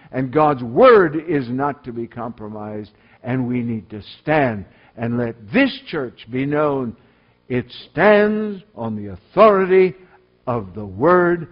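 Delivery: loud, high-pitched preaching at full force; fiery sermon delivery. Acted. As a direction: thunder it from the pulpit like a revival preacher.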